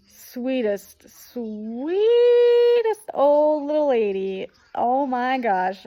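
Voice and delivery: high, loud, stretched-out adoration; effusive gushing. Real, not acted. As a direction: gush with real affection, drawing out the first word. Gushing over their kind host.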